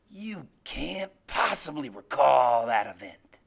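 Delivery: low, loud, very slow insistence; menacing father. Acted. Father denies what his son recalls, low slow menace. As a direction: press each word down on him.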